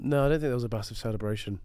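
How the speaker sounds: ordinary talk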